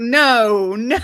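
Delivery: loud laughing denial. Retold or imitated. Retelling her reaction to the pastor claim, laughing. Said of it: "I'm like".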